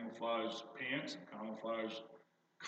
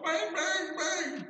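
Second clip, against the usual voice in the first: put-on much higher bang-bang sounds, like a kid; gunshot noises; 5 dB louder, much slower than usual. Grandson shooting his plastic gun at the goblin, imitated bangs. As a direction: pop out toy gun shots.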